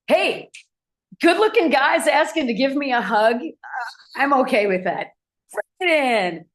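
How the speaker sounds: light up at the good-looking guy's offer; delighted. Real, not acted. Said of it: "own delighted reaction".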